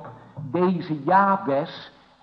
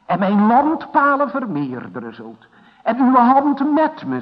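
fervent prayer; 5 semitones higher, 7 dB louder than usual. Acted. Preacher voicing Jabes' prayer.

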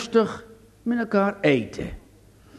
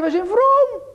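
mock pious brothers; 3 dB louder than usual. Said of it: "ze zeiden".

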